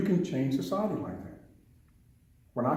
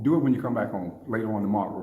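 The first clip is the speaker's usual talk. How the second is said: normal talking voice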